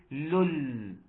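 "لول" cut out alone, final l held; isolated syllable. Shown as a demonstration. Teacher cuts the syllable out for students, "لول" drawn out. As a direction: sound out "لول" by itself, lengthened. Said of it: "هاخده أقطعه لوحده".

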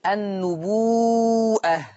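النبوءة very slow, long و before a hamza; hamza with full madd. Shown as a demonstration. Teacher showing the Warsh word النبوءة. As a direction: hold the و of النبوءة long, then a glottal stop. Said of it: "يقرأها".

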